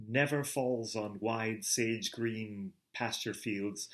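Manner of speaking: plain reading voice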